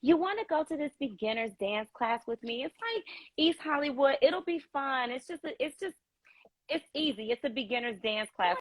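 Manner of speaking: imitating a friend's sugary baby voice. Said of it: "she put the baby voice on".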